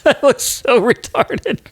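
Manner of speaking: high, squeaky laughing voice, slowing down; helpless laughter. Real, not acted. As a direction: squeeze the words out through real laughter. Cracks up at his own build.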